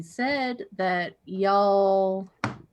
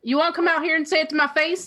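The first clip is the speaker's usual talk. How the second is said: challenging retort; 7 semitones higher, 4 dB louder, much faster than usual. Acted. Answering heckler in the crowd.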